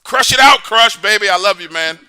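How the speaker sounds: loud, high, rousing shout; hollered send-off. Real, not acted. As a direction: holler an affectionate goodbye to the caller.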